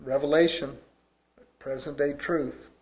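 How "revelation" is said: normal talking voice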